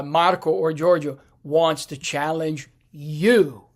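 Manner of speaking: "you" set apart and stressed at the end. Shown as a demonstration. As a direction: hold back, then land firmly on "you". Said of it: "the emphasis is on".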